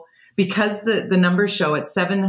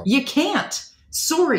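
pitched-up mock apology; imitated refusal; 7 semitones higher, slower than usual. Keith answering the would-be skier, high mock-sorry refusal. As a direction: turn them down in a high voice. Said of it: "And Keith's like".

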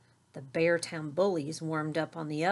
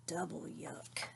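soft, muttered disgust to himself; whispered; 14 dB quieter, slower than usual. A narrator's reading. Brother reacting privately to Papa's romance, whispered disgust. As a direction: breathe it out under your breath. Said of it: "he whispered to himself".

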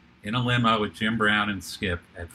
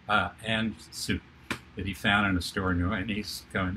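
normal talking voice

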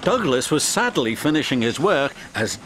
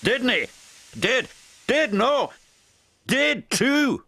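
high, indignant tit-for-tat; childish bickering; 9 semitones higher than usual. A narrator's reading. Twin engines argue over the crash, bickering. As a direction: trade quick contradictions, pitched high.

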